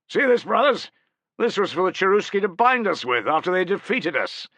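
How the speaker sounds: a commander calling out high and loud to his troops; rallying cry. Acted. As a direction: rouse the men, let it ring across the crowd. Rallying soldiers with captured chains.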